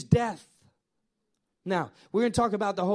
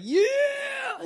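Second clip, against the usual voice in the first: triumphant shout. Retold or imitated. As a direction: yell it out like you're on top of the world. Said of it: "He's like".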